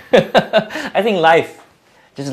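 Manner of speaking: a real laugh spilling into the words; high, slow laughing start to the line; laughing it off before going on. Real, not acted. Laughs after food joke.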